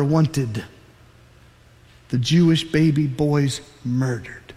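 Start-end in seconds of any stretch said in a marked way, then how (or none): none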